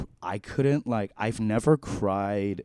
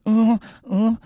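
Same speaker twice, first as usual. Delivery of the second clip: high, loud hammed-up moaning; breathy mock moans, much higher; mock sexy moans; 13 dB louder than usual. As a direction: fake sexy moans for a laugh. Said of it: "sexy noises".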